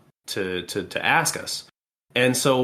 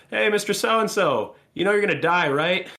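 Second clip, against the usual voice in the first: higher, casual doorway call; breezy doctor calling in; 8 semitones higher than usual. Retold or imitated. Doctor leans into the doorway to tell patient, breezy higher call.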